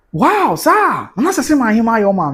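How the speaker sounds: excited wow. Real, not acted. Excited reaction to chief photo.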